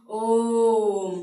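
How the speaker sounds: ओम stretched very slowly and loud; very long om. Shown as a demonstration. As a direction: hold ओम out for triple length. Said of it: "इसमें और ज़्यादा लग रहा है".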